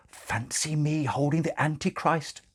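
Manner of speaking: high, delighted nun voice; gushing nun. Acted. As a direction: marvel at it like a giddy nun. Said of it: "said Sister Mary".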